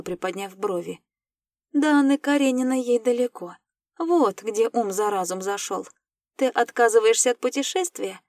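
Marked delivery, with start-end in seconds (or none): 1.7-8.2 louder, lifted challenging tone; provocative. A narrator's reading. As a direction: goad him with a teasing challenge. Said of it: "Провокационно поинтересовалась я".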